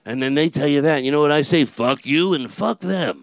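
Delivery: loud ranting Carlin, slowing at the end; George Carlin impression. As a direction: rant hunched over like Carlin. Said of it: "but I can go".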